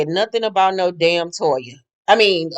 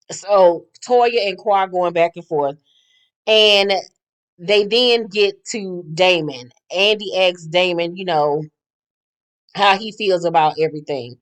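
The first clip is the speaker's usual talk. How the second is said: normal talking voice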